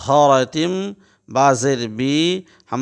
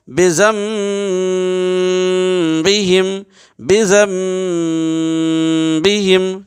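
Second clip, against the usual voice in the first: slow, drawn-out tajweed chant, raised pitch; Quranic recitation; 4 semitones higher, much slower than usual. Shown as a demonstration. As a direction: intone each syllable like a qari. Recites example very slowly. (تجوید کے ساتھ آہستہ تلاوت)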